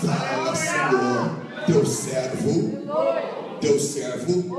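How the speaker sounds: loud dragged-out cry, stretching teu servo; loud hammed-up answer. Shown as a demonstration. Preacher mocking a clumsy answer to God, loud and drawn out. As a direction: overdo it, bellowing the reply. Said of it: "diga dessa maneira".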